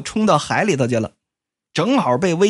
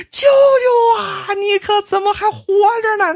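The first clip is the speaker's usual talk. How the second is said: wailing cry; 4 dB louder than usual. Acted. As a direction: sob out the shock that he is alive. Mourners see the dead man alive.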